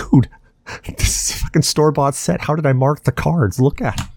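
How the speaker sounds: replaying an incredulous objection; mock exasperated protest. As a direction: protest your innocence, voice lifted. Retold quarrel over cheating accusation.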